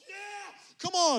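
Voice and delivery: mock prayer-meeting cry, pitched high; fervent praying. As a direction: shout it out like a prayer warrior in full flow. Imitating loud Haitian prayer meeting.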